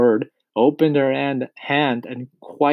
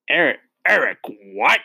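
mild, incredulous shouted question; shouting; 3 semitones higher, 3 dB louder than usual. A narrator's reading. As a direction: demand it from the pupil, voice raised. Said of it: "the Trunchbull shouted".

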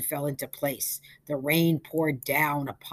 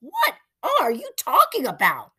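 much higher, louder outraged question; scandalized old lady demanding; outraged high exclamation; indignant disbelief; 11 semitones higher, 10 dB louder than usual. Acted. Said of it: "declared Missus Foley".

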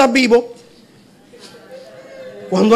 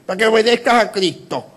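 mocking other pastors; 4 dB quieter than usual. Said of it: "a pastores diciendo".

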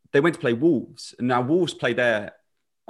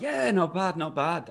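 mock Scouse attempt; 7 semitones higher, 3 dB quieter, slower than usual. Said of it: "He's like".

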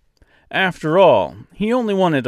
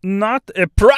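high, loud Austrian-French, cut off; Austrian-French accent; 10 semitones higher, 5 dB louder than usual. A narrator's reading. Stork attacked mid-sentence, Austrian-French accent cut short. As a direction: repeat it in accent and choke off mid-word. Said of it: "in a strangely thick, almost Austrian accent".

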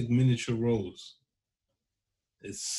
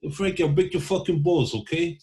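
Italian mobster voice; 7 semitones higher than usual. Pretend. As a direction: bellow it like a wiseguy in a tracksuit. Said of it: "what you talking about there, Tony".

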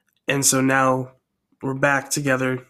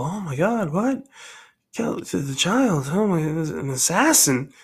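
high, gasping disbelief at the news; shocked townsfolk; 3 semitones higher than usual. Acted. Townspeople hearing of a plot to kill the child, aghast. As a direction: play the crowd aghast, voice jumping up.

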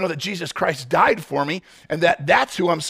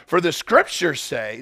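usual speaking voice

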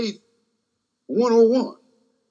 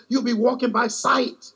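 higher and louder, pushing the crowd; fervent preaching; 6 semitones higher, 3 dB louder than usual. Real, not acted. Preacher driving the point home to the church, fervent and rising. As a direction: climb to the peak of the sermon.

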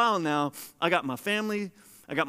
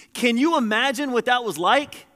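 ordinary talk, nothing marked

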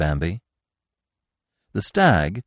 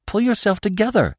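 high, urgent plea; begging. A narrator's reading. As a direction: beg with the pitch pushed right up. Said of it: "Bambi begged".

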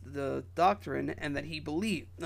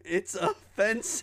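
laughing protest; 4 semitones higher than usual. Real, not acted. Co-host protesting the accent while laughing.

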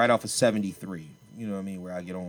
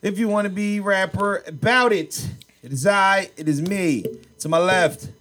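raised, slow announcer delivery; show-host introduction; 7 semitones higher, 5 dB louder, slower than usual. Real, not acted. Host introducing himself and the episode, slow announcer style. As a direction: announce yourself grandly, stretching the words.

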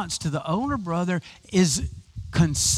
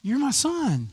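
higher, gently reassuring father; a loving dad soothing his son, pitched up; tender father; 7 semitones higher than usual. Retold or imitated. Father reassuring his older son, higher and gentle. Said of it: "it's like".

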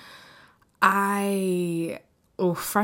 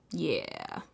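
breathy whispered yeah; 11 semitones lower, 6 dB quieter than usual. Real, not acted. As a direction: whisper it to yourself. Whispered aside.

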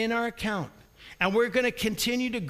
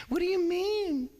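high, whining indignant question; indignant whine; 10 semitones higher than usual. Retold or imitated. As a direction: protest like a wounded innocent. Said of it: "And you say".

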